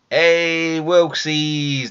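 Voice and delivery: loud, high, happy hey; excited greeting. Real, not acted. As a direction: call out to the donor with a cheer.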